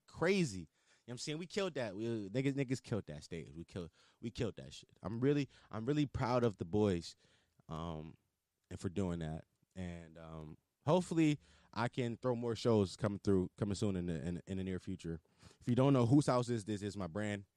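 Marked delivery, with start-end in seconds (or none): none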